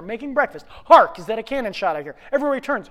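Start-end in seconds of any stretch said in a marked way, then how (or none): 0.9-2.1 loud, punched HARK; declaimed rehearsal. Acted. Actor rehearsing all day, loudly declaimed. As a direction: belt out the stage line.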